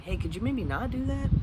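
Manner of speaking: mock nonchalant request, rising like a question. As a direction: toss it off like a casual question. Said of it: "like, nonchalant".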